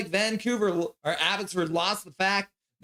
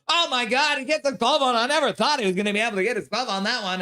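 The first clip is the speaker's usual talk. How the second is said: hammed-up excitement, higher and fast; mock thrilled homer call; 7 semitones higher, 3 dB louder, faster than usual. Home-team announcer hyping a routine glove save, mock thrilled. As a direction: oversell an ordinary save like a miracle. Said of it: "I have to pretend like it was the greatest save".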